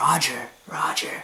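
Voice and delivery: whispered slow Roger, Roger; mock radio reply. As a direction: breathe it like a radio check. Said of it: "he's basically like".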